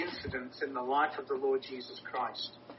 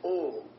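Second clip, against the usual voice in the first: "all" repeated with a firm, louder hit; emphasis on all; 3 dB louder than usual. Shown as a demonstration. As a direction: land squarely on "all" a second time. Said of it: "I can't emphasize that word enough".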